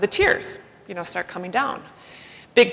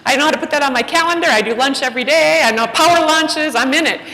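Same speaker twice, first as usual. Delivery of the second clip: cocky self-assured retort; 6 semitones higher, 3 dB louder than usual. Retold or imitated. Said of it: "I'm like".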